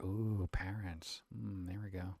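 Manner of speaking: unvoiced spooky whisper; mock whisper. Streamer notices parents reading, unvoiced whisper. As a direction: hush like the parents might hear.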